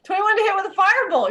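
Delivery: high, fast excited echo. Real, not acted. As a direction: repeat it quick and bright. GM repeats roll excitedly.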